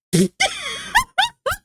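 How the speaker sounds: squealing high laugh. Real, not acted. A high laugh after guessing.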